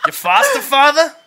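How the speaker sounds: laughing through lines. Real, not acted. Friends breaking character mid-scene, laughing through lines. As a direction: lose it while saying the lines.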